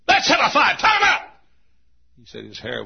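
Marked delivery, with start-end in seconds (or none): 0.1-0.8 shouted dare. Acted. 0.8-1.3 high, loud challenging shout; scrappy street kid. Acted. Street boy wants a fight.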